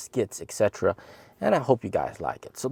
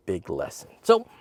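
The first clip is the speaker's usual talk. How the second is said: ordinary delivery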